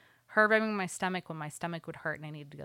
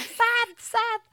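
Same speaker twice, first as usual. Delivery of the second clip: much higher, louder mock yell; yelling for Seth; 5 dB louder than usual. Mom retells calling her husband from the bathroom, high panicked mock yell. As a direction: call for help in a panic. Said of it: "yelling for Seth".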